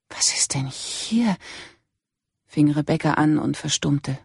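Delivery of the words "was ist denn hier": slow, breathless whisper; shocked whisper. A narrator's reading. Rebecca whispers at the dead woman, stunned.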